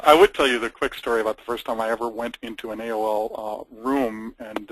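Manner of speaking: usual speaking voice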